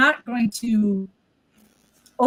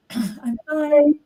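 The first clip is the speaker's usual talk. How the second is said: higher-pitched, unconvincing reassurance; mock denial, pitched up; pretending to be fine; 5 semitones higher than usual. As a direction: insist you're okay when you clearly aren't. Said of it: "And you're not fine".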